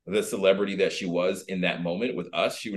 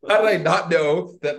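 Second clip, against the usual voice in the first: freaking out; 6 semitones higher, 4 dB louder than usual. Retold or imitated. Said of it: "starts freaking out".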